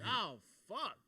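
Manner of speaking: loud, high mock-dread curse; retold alarm. Passenger realising it's nine eleven mid-flight, alarmed.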